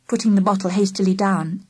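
usual speaking voice

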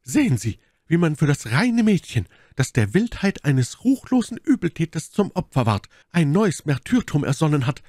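hohe, gebieterische Milady; majestätisch anklagend. A narrator's reading. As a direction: wie eine beleidigte Königin anklagen. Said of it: "mit der Majestät einer Königin". (mit majestätischer Empörung)

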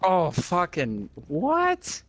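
high, drawn-out disbelieving cry; stunned yelp of disbelief; shocked outburst. Real, not acted. Sudden death in game.

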